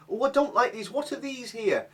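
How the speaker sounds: high, whining mock mum; mimicking mum. Mum complaining to son about pasta, high mock whine. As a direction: whinge like a picky mother.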